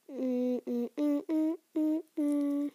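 little rising hummed notes; idle humming. Real, not acted. Vlogger searching for podcasts, humming idly. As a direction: hum to fill the wait.

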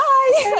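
loud high burst of laughter. Real, not acted. Mother laughing loudly while retelling her scare, high burst. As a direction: crack up loudly.

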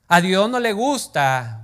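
loud, slow, startling shout; sudden shout. Shown as a demonstration. Preacher startling the church with a sudden shout. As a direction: jolt the congregation. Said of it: "más de uno se asustó".

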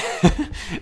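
bright high giggle; high laugh. Real, not acted. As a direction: laugh at your own bit. Laughs at own impression.

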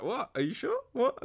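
high-pitched, incredulous questioning; quick, rising double-take in mock confusion; baffled barista. Young barista to Penguin ordering oat milk, baffled.

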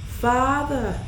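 high, loud call with a falling end; crying out. A narrator's reading. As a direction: call out to a father far above. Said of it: "Jesus cried".

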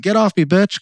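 angry retort. Retold or imitated. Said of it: "I'm like".

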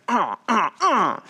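short, high stabbing grunts; mimed stabbing grunts. Retold or imitated. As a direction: grunt with each imaginary stab. Acting out Judas stabbing in back.

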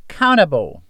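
"Countable" in an American accent, T softened toward a D; American pronunciation. Shown as a demonstration. Teacher models the American "Countable" for students, softened T. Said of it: "O americano já tira esse som do T e fica mais um som de D".

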